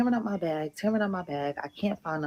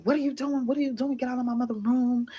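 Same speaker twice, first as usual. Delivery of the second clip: higher, sharper mock yell; retold alarmed yell; 6 semitones higher than usual. Friend catching her in his mother's room, mild mock yell.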